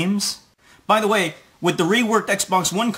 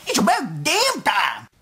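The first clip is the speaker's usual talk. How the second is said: high excited cry of "It's about damn time!", slowed; over-the-top celebration, climbing higher on the second take; emphatic exclamation. Shown as a demonstration. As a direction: shout it out like it's overdue. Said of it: "You're supposed to say".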